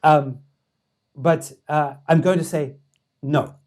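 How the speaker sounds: bad imitated accent, loud and halting; deliberate verdict in a poor accent, with pauses; Dalai Lama. Dalai Lama turning down the pitch, badly imitated accent. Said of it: "I'm doing a terrible Dalai Lama accent".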